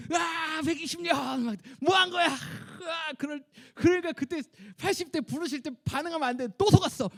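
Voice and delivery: high, loud mock wail of a cheated old man; mock screaming Moses. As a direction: ham up Moses screaming over his wasted years. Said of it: "비명 지르면서". (속았다고 비명 지르는 모세 흉내)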